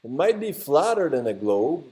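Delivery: usual speaking voice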